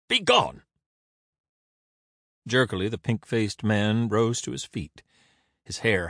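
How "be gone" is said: commanding dismissal. A narrator's reading. Render orders Caesar away.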